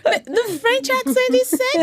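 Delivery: put-on high-pitched, laughing French-accented English; joking French-accented English; playful French accent. Joking demo amid the laughter.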